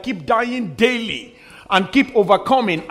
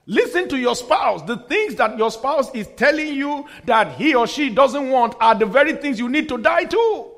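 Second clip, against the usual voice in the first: raised pitch, forceful exhortation; impassioned preaching; 5 semitones higher, 3 dB louder than usual. Real, not acted. Pastor urging couples to listen to each other, impassioned preaching. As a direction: drive it home to the whole congregation.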